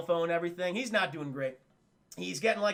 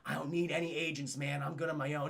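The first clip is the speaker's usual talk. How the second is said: low, subdued refusal; 4 semitones lower, 5 dB quieter than usual. Retold or imitated. Brando-like character refusing agent.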